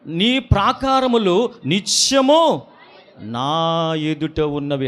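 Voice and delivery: slow, solemn Bible reading; scripture reading. Acted. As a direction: read each phrase slowly and weighty. Reads scripture slowly.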